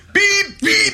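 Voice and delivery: high piping whistle beeps; imitated whistle. Mimicking the coach blowing his whistle in the brawl, high beeps. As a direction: chirp it like a referee's whistle.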